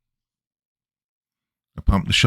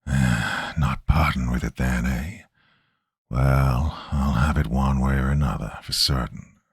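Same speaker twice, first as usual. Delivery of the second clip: low, slow, half-whispered wheeze; raspy chain-smoker voice; 7 semitones lower, slower than usual. A narrator's reading. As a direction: rasp it out like a lifelong smoker. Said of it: "a raspy voice like that of a lifelong chain smoker ... half a whisper, half a wheeze".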